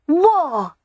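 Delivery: put-on high, gleeful child voice; small boy savouring his choice; little boy with relish. A narrator's reading. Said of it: "the boy said with relish".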